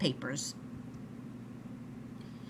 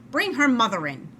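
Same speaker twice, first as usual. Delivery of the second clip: louder, firm command as the doctor; doctor's order; 5 semitones higher, 6 dB louder than usual. A narrator's reading. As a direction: give it as the impatient doctor.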